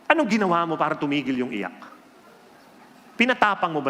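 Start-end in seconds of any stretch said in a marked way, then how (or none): none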